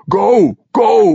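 loud, high shouted command; commander's sharp yell; urgent order. A narrator's reading. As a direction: order the troops to move. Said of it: "ordered Ogden".

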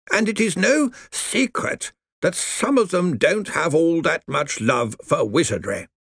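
put-on dry crackling old lawyer voice; grave undead lawyer, slow; crackly zombie lawyer. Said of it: "his voice crackled".

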